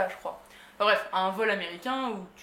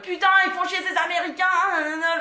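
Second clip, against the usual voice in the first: higher, louder angry grumble at the Americans; mock furious rant; annoyed rant; 8 semitones higher, 7 dB louder than usual. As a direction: vent your irritation out loud. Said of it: "Et donc, énervée".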